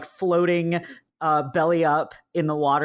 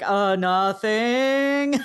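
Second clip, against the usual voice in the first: drawn-out, higher-pitched evasive answer; feigned innocence; 5 semitones higher, much slower than usual. Retold or imitated. Junpei dodging what they're talking about, mock innocent. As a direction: stretch it out, playing innocent.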